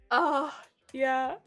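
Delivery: ordinary talk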